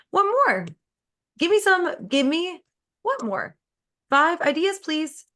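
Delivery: playfully pleading for ideas; eager brain talk. Pretend. Eagerly asking her brain for ideas.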